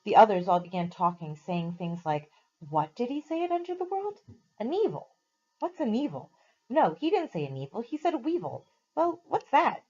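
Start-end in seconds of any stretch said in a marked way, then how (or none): 2.7-9.8 high, bewildered animal voices; puzzled creature chatter. A narrator's reading. Animals puzzling over Aslan's word, high and bewildered. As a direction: babble like confused new beasts.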